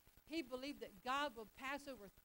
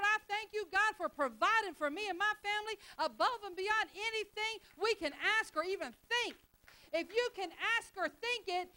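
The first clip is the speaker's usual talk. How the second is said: high, loud confident proclamation; preacher shows the right way to pray; bold faith prayer; 8 semitones higher, 10 dB louder than usual. Shown as a demonstration. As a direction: declare it like it's done. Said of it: "you come to God and you say".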